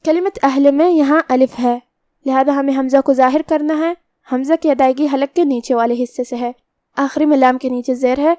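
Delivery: usual speaking voice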